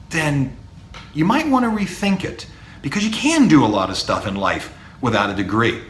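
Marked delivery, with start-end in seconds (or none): none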